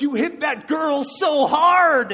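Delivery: loud, high, slow drawn-out shout; coach's yell. Retold or imitated. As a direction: bellow it slowly with glee. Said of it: "He's like".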